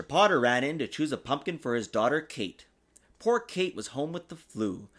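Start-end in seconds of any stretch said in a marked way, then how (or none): none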